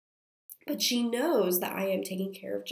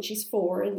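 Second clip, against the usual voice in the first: normal talking voice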